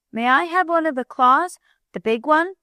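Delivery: a hint of a begging child; begging little boy. A narrator's reading. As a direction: plead like an eager little boy. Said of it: "begged Bunny".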